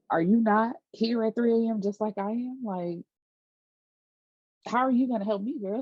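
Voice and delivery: mock disbelief, voice climbing; incredulous. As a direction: ask it like it's absurd. Voicing Conrad's incredulous reaction.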